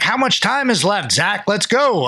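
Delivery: high, pressing mock yell; imitated heckler. Heckler in the crowd to the referee, mock high urging. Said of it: "And he's like".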